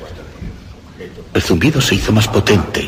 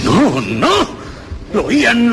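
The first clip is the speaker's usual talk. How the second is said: lo oían pushed higher, alarmed; sudden realization climbing up; rising alarm; 13 semitones higher, slower than usual. Acted. The murderer realizing the officers hear it, alarmed. Convencido de que lo oyen.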